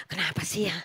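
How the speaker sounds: hushed, unvoiced muttering to herself; whispered self-accusation. Wife whispering her accusing thoughts about her son, hushed. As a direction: whisper the doubt under her breath.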